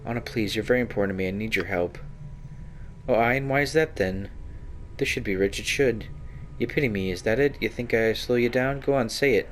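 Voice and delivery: a hint of a suspicious rogue, sharp and defensive; a touch of a glaring, jealous companion; lightly voiced prickly Anna. Companion Anna snapping at the hero about the succubus, lightly voiced.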